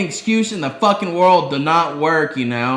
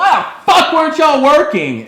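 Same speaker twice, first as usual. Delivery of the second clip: much higher and louder, a furious shout at slackers; retold angry yell; 12 semitones higher, 9 dB louder than usual. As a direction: yell it like a fed-up boss catching them slacking. Boss yelling at lazy coworkers.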